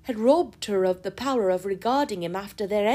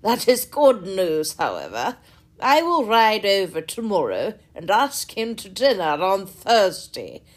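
loud, booming, jovial squire; loud hearty country gentleman; 6 dB louder than usual. A narrator's reading. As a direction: boom it out like a cheerful host. Sir John voiced by reader.

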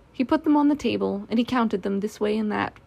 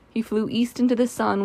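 ordinary delivery, nothing marked

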